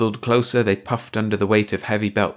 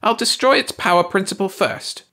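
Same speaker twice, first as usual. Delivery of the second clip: high alien voice; 11 semitones higher, 3 dB louder than usual. A narrator's reading. Ursi continues angrily.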